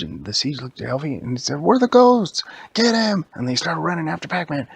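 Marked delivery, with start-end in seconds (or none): 1.6-3.3 high, loud rallying shout; excited battle cry. Acted. Storyteller as heroes chasing Pac-Man, excited battle cry. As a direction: shout it like launching a chase.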